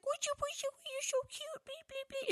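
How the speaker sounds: put-on very high cooing baby talk to a kitten; baby talk to a cat. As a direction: coo at the cat like it's a baby.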